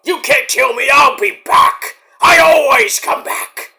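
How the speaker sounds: high, loud killer-doll taunt, slowing at the end; Chucky threat. Retold or imitated. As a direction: gloat like a horror doll that can't die. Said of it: "Megan is basically like the next Chucky".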